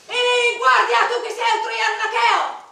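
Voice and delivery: loud, high demand, fast; challenging shout. Pretend. Challenging the guard in a mock battle, loud shouting. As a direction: call out to the guard as a sentry.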